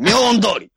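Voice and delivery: 明恩通り thrown out in one straight line; 明恩通り level and forceful, no dip; straight like a fastball. Shown as a demonstration. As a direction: fire off 明恩通り like a straight pitch. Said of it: "ダルビッシュのストレートみたいな".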